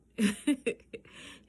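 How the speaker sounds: bursting laugh; loud laugh. Real, not acted. Laughing mid-story.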